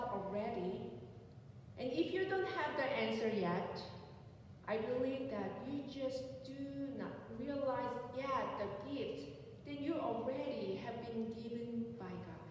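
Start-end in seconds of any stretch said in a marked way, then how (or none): none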